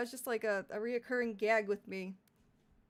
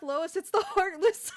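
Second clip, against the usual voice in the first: higher, louder, slow goofy cartoon dad; 9 semitones higher, 10 dB louder, slower than usual. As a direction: boom it out like a dopey sitcom dad. Cartoon dad line, Lois named.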